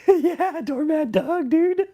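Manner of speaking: squeaky high mocking voice, loud; mock falsetto. Streamer mocks Doug's nickname in squeaky falsetto.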